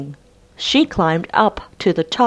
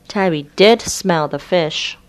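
ordinary delivery

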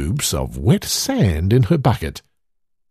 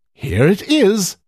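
Hedda's voice; 8 semitones higher than usual. A narrator's reading. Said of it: "said Hedda".

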